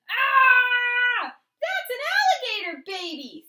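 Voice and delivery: high, loud panicked shout; yelling in shock. A narrator's reading. Parents shrieking at the baby that bit them. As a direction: scream it like parents bitten on the nose. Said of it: "and they yelled".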